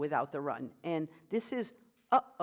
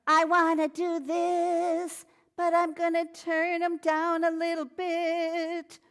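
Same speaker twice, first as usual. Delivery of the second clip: high, loud, slow and warm; warm tipped voice; 10 semitones higher, 7 dB louder, slower than usual. Shown as a demonstration. Singing teacher demonstrates a warm tipped tone for the class. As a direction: sing it with warmth by tipping the larynx. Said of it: "I add a little warmth by tipping it".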